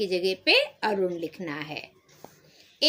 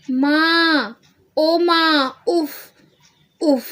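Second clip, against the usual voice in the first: a loud, higher mock groan of pain; 7 semitones higher, 4 dB louder, much slower than usual. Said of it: "जोर से कराहकर". (ज़ोर से कराहना, नाटकीय)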